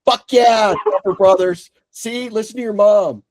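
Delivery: high, loud gleeful cheer; mock cheer. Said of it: "And I'm like".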